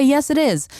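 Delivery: high, bright-eyed "Yes, it is"; chirpy mock yes. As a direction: chirp it like a cheerful believer. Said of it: "I would love to say".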